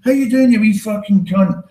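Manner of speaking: high, loud, cheery Scots hello; hammed-up chirpy Glaswegian hail; affectionate Scottish greeting. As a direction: fling the insult out as a fond Scottish greeting. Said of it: "that's used as a term of affection".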